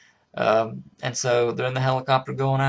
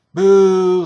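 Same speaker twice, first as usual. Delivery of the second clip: loud, much higher jeering boo; mock booing; 8 semitones higher, 12 dB louder than usual. Seals jeering the new guy, loud mock boo. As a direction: boo him off the stage.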